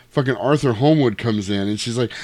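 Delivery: ordinary talk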